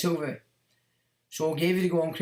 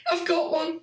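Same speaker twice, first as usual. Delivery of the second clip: much higher, eager squeal repeated; mock excited shriek; 13 semitones higher than usual. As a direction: squeal it like you've just spotted one. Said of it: "they're like".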